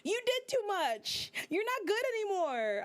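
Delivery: indignant verdict on her; sassy scolding. Retold or imitated. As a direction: scold her like a friend who messed up. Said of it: "I'm like".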